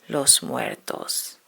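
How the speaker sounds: ordinary delivery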